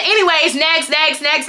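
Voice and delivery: high, dismissive repeated dismissal; dismissive brush-off. Retold or imitated. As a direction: brush someone off impatiently. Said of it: "like, rushed me off".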